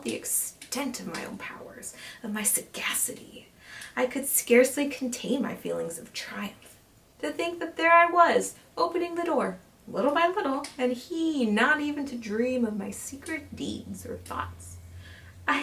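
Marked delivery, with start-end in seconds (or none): 0.0-3.5 voiceless, gleeful whisper; gloating whisper. Acted. Killer gloating to the listener, in a whisper. Gloating over his cunning. 7.2-11.5 gleeful triumph. Acted. Killer boasting to the listener, gleeful and high. Triumph at his secret deeds.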